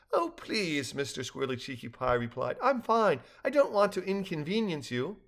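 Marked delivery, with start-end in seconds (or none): 0.1-1.0 lightly voiced high-pitched little guest; polite protest. A narrator's reading. Small guest politely protests in story. 2.6-5.2 high-pitched, deferential refusal; polite little guest. A narrator's reading. Small guest politely declines in story.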